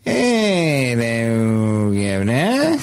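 put-on slow Boston Kennedy accent, long drawn vowels; Bobby Kennedy accent. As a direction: greet him like a Kennedy, Boston vowels stretched. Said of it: "Let's see if you can place this, uh, regional dialect".

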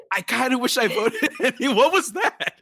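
high, laughing self-quote; confessing a regretted vote to co-hosts, laughing; laughing confession. Retold or imitated. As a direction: giggle through the admission. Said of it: "I was like".

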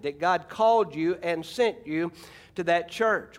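ordinary talk

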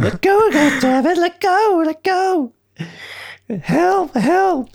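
high, loud, frantic cries; mock yelling kid. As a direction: squeal it like a panicking boy. Retelling boy yelling in movie.

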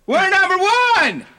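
high, slow victory yell; victory shout. Shown as a demonstration. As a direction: shout it like you just won the championship. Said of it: "How'd you say it?".

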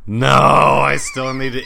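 loud, drawn-out cry of no; guilty outburst at a forgotten chore; exasperated outburst. Real, not acted. Exclaims over forgotten Christmas present.

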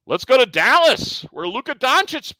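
ordinary talk, nothing marked

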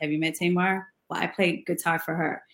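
low, soft, understated imitation. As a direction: imitate a star's quiet, deep murmur. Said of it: "this is how he always talked".

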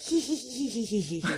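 soft high sinister snicker; hyena giggle. Shown as a demonstration. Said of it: "sound like a human laughing or giggling".

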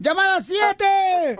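slow, high announcer yell; shouted call. Shown as a demonstration. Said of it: "hazlo otra vez, [name]".

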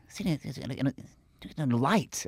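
halting, stammering impression; tentative stab at a famous stammerer; Woody. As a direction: try a faltering, not-quite-there impersonation. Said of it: "I can't do Woody".

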